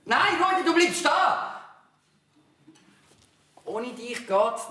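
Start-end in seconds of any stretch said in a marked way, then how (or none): 0.0-1.6 loud, high call to stop him; shout. Acted. Father figure shouting at Rudi not to leave. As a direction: yell him back before he leaves.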